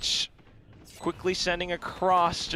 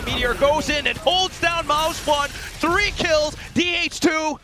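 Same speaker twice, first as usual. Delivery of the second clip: excited call; 7 semitones higher than usual. Real, not acted. Caster calls three kills.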